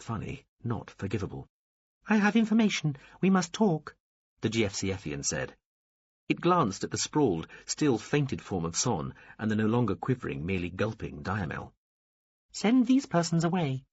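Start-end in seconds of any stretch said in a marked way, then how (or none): 2.1-4.0 high alien voice. A narrator's reading. Alien insists on talking. 12.5-13.9 high alien voice. A narrator's reading. Alien orders the women out.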